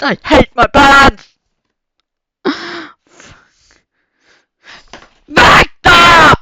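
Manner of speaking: screaming Ut over and over, very high and loud, cracking to tears; real furious yelling. Real, not acted. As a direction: throw them out of the room at full volume. Real scream at parents again.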